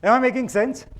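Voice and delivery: re-ask it with energy so everyone answers; loud emphatic repeat. Real, not acted. Repeats question to the group.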